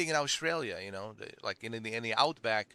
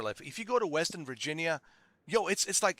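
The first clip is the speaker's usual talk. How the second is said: ordinary delivery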